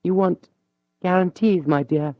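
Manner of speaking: a proud lady suddenly meek; humble young woman. Acted. Mathilde to Julien, pale and humbly pleading.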